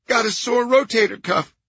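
loud, high anxious explanation; rattled nasal caretaker; high-pitched nasal man. A narrator's reading. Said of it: "High-pitched, nasal voice".

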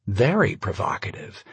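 usual speaking voice

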